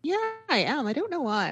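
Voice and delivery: put-on high, swooping kid's admission; imitated kid. Kid owning up to mom, imitated high-pitched voice. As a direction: put on a child's high confession.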